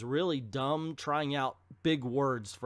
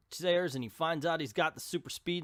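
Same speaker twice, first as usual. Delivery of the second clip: usual speaking voice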